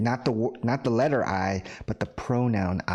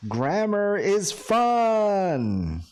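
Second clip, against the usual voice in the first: much higher, louder and very slow, hammed-up cheer; mock enthusiasm; 10 semitones higher, 3 dB louder, much slower than usual. Teacher hams up a joke example sentence to students. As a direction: sell it like an ironic sales pitch.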